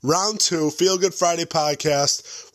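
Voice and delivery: high, bright show-host opener; hype podcast intro. Acted.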